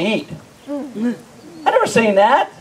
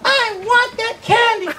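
high, drawn-out mock tantrum; whining child; 11 semitones higher, slower than usual. Kid demanding candy from mom at the store, whining. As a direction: stretch each word in a high bratty whine.

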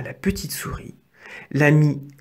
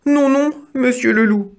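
high-pitched mouse voice, much higher; little mouse protesting; 9 semitones higher, 4 dB louder than usual. A narrator's reading. Mouse Didi contradicting the wolf, high protesting voice.

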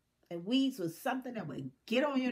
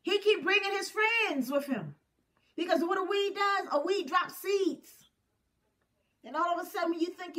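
preaching; 9 semitones higher, 6 dB louder than usual. Acted. Preaching at full force.

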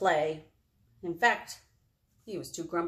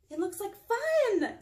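high raccoon voice; 12 semitones higher, slower than usual. Acted.

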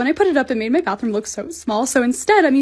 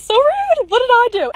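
mock indignant; 10 semitones higher, 6 dB louder than usual. Complaining about rude store staff.

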